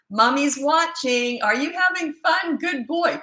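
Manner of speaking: high, slow sing-song mom voice; sing-song to toddler. Retold or imitated. Mom calling to son from the window, high and sing-song. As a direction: coo encouragement to a little boy.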